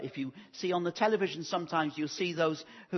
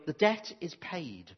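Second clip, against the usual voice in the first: ordinary delivery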